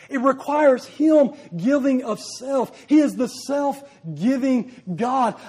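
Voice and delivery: loud and measured, slowing on the key lines; preaching at full force. Acted. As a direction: lean into every word of the self-giving God.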